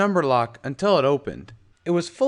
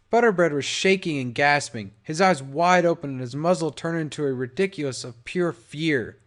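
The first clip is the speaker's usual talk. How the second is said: ordinary delivery, nothing marked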